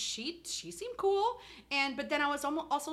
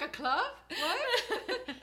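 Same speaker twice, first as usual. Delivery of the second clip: laughing confusion; 7 semitones higher, much slower than usual. Retold or imitated. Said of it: "I'm like".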